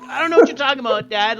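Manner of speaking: put-on teen self. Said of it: "I'm like".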